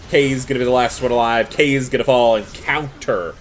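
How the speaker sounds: loud, fast esports casting; play-by-play. Acted. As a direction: call the teamfight hype.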